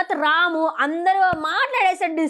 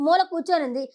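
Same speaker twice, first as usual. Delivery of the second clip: ordinary talk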